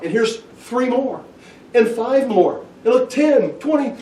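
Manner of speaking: high, mounting showman's count; mock amazement. Crowd watching bread multiply, acted-out amazement.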